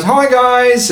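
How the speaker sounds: very high, loud little greeting; high-voiced character greeting; perky first mate. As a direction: pitch it way up and call hello. Game master voices the first mate.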